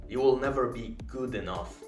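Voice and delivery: usual speaking voice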